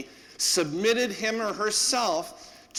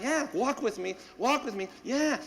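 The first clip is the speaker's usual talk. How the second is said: puppy praise; 7 semitones higher than usual. Retold or imitated.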